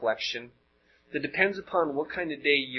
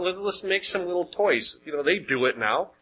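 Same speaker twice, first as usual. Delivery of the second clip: ordinary delivery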